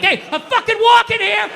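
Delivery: high-pitched, confrontational New York tough guy; indignant movie-tough-guy outburst; old-school Dustin Hoffman, De Niro. Acted. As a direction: yell it like an indignant pedestrian picking a fight. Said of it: "old school Dustin Hoffman, De Niro".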